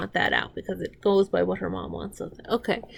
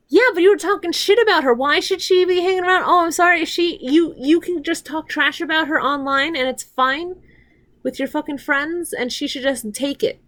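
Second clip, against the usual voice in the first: angry rant; 6 semitones higher, 4 dB louder than usual. Real, not acted. Ranting at a show character.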